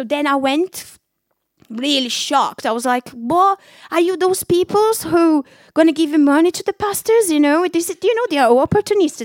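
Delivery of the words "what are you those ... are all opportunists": higher, louder, scoffing tone; scornful disbelief. Retold or imitated. Mocking her friend for joining the church, scornful. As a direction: sneer at the idea of pastors.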